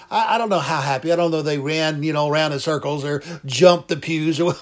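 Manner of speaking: ordinary talk, nothing marked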